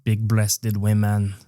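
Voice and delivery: put-on French accent, drawn out. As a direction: grumble like a scornful Frenchman. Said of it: "I'm doing French".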